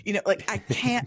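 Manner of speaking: ordinary talk, nothing marked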